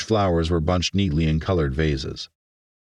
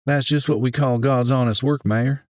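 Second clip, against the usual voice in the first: higher-pitched Southern woman; strong Southern accent; 7 semitones higher than usual. A narrator's reading. Southern florist greets the mayor, in a Southern accent. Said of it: "responded in an accent so southern".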